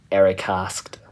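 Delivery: plain reading voice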